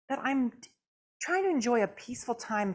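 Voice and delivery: usual speaking voice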